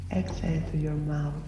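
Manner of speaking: ordinary talk, nothing marked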